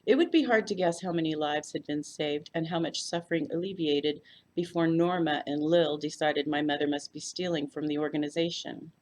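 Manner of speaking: ordinary delivery, nothing marked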